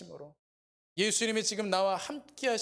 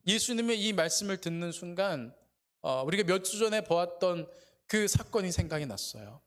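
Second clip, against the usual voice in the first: ordinary talk, nothing marked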